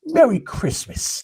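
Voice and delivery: high, slow New York Italian traffic cop; slow, high imitation in a New York Italian accent. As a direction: drag out Merry Christmas like a gruff New York Italian. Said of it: "Just the way he said that".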